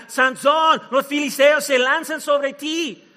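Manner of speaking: high, loud alarm call; acted shout of alarm. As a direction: cry out a drawn-out warning. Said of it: "a gritar".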